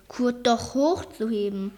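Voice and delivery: ordinary delivery, nothing marked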